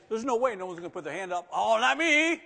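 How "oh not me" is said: mock reluctance. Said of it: "mocking a shy churchgoer".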